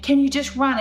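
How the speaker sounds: high, loud, urgent plea; screaming. Retold or imitated. Wife warning the stranger to get away, screaming. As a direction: yell it as a desperate order to flee. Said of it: "I'm screaming at him".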